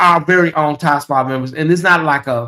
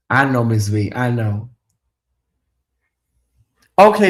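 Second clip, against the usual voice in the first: usual speaking voice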